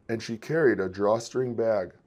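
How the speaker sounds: plain reading voice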